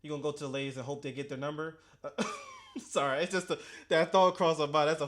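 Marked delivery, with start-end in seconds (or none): 2.1-2.7 sudden squeaky laugh; high burst of laughter. Real, not acted. Laughs at funny thought.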